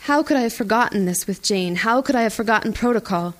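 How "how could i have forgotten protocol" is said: ordinary delivery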